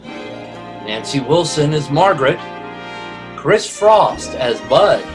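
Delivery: ordinary talk, nothing marked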